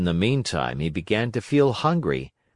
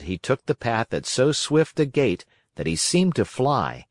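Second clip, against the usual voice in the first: usual speaking voice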